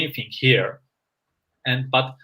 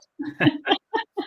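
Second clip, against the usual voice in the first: embarrassed laugh. Real, not acted. Laughing at own French.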